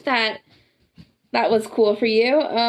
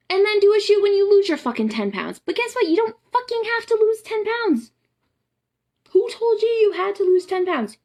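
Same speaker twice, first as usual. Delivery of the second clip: heated rant; 9 semitones higher, 4 dB louder than usual. Real, not acted. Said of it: "done ranting".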